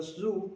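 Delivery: "zoo" as one single syllable. Shown as a demonstration. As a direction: say "zoo" in one beat, one long vowel. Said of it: "first three letters of these were in one syllable".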